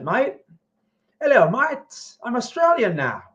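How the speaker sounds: high-pitched, playful mock Australian accent. Streamer greeting an Australian viewer, mock Australian accent. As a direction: put on a hammy, singsong Aussie accent. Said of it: "speaking in my Australian accent".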